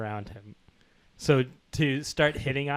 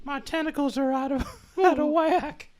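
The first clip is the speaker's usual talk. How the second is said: much higher Southern belle; Southern girl voice; 12 semitones higher than usual. Acted. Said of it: "Southern girl voice".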